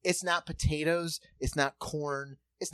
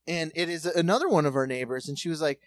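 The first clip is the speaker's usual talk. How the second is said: ordinary talk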